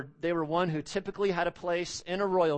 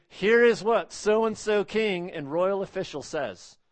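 loud, high, drawn-out royal announcement; herald's proclamation; 6 semitones higher, 8 dB louder, slower than usual. Acted. Herald rolls into town to declare the king's message, proclaiming. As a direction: proclaim it slowly, like a horn blast. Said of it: "he would declare".

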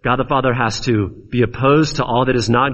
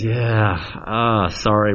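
low, halting apology with drawn-out hesitation; awkward banker; 4 semitones lower, much slower than usual. Acted. Banker dodging a customer's question about his bills, awkward and hesitant. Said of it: "real awkward pause".

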